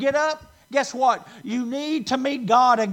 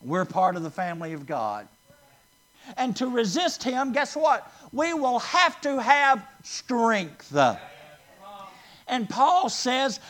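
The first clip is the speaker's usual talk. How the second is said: usual speaking voice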